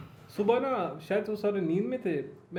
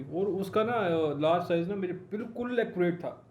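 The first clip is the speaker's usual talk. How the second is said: usual speaking voice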